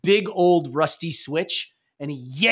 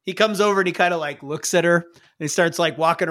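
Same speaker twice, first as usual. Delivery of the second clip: ordinary delivery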